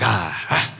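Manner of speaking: low, soft, hesitant haggling; sheepish lowball offer. Retold or imitated. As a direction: mumble a cheeky tiny offer. Customer lowballing the price.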